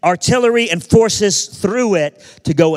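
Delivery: loud, high, driving storyteller delivery; forceful preaching. Real, not acted. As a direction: preach the battle history with force.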